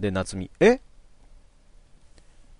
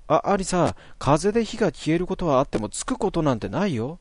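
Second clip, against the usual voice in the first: put-on higher, insistent boy's objection; protesting Natsumi; 6 semitones higher than usual. Natsumi protesting to Alisa, lightly higher voice. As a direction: push back at her, rattled.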